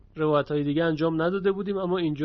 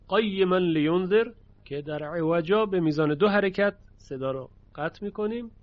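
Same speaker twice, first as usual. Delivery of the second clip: ordinary talk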